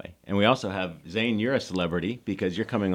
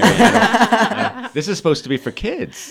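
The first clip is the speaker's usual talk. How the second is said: laughing; 12 semitones higher, 7 dB louder, slower than usual. Real, not acted. Laughing at vulgar joke.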